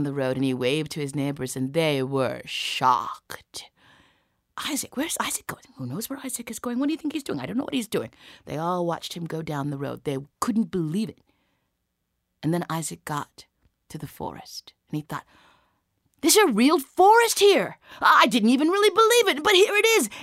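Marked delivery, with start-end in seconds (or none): 4.6-8.1 hushed, high-pitched busybody chatter; nosy whispering onlookers; gossiping neighbours. Acted. Shocked neighbours gossip about Isaac. 16.2-20.1 high, loud burst of astonishment; wide-eyed excited outburst; amazed discovery. Acted. Isaac amazed the forest exists.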